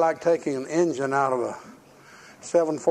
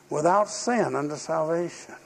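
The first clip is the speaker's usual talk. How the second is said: ordinary delivery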